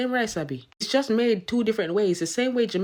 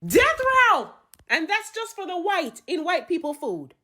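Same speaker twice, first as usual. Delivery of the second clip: high, loud theatrical judge; high-pitched mock outrage from the bench; shouted verdict; 9 semitones higher, 7 dB louder than usual. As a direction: yell the sentence, then justify it sternly.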